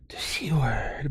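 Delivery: hushed, drawn-out words after a gasp; gasp, then say it slowly and softly; mock scandalized. Imagined shocked American reaction to word.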